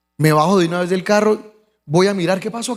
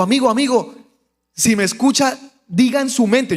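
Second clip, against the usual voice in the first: higher, urgent re-enacted plea; urgent calling out; 5 semitones higher than usual. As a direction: get through to the injured man. Llama al herido para que oiga. (llamado urgente al herido)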